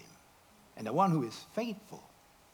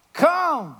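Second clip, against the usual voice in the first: calling out; 10 semitones higher, 8 dB louder than usual. Acted. Jesus calls Peter.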